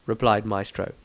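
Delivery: usual speaking voice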